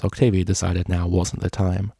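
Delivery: plain reading voice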